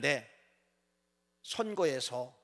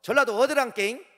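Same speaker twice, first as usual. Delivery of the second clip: mock Jeolla dialect; 5 semitones higher, 4 dB louder than usual. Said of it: "옆에 가서 저".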